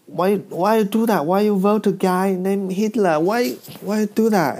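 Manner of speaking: high, pleading mock indignation; indignant questioning.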